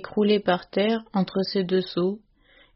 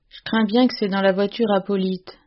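ordinary delivery, nothing marked

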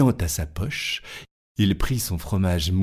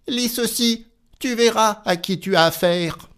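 high, boastful and louder; proud tailor; 13 semitones higher, 3 dB louder than usual. Acted. Tailor showing the giant his belt, high and boastful. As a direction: thrust it at him proudly.

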